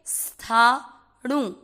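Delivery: स्थाणु said very slowly, st cluster with no vowel before it; no i before स्थाणु, start straight on st; careful slow स्थाणु. Shown as a demonstration. Teacher slowly models स्थाणु for students. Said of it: "स, ये आधा स ऐसे बोल के".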